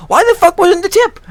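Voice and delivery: high, loud, indignant burst; furious demand. Real, not acted. Customer asking about the missing tip refund, furious demand. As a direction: demand it at a yell.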